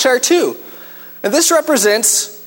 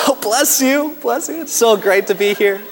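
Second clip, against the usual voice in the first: fake-friendly churchgoer; 3 semitones higher than usual. Pastor mocking a dad greeting people at church, sugary. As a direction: lay the fake charm on thick.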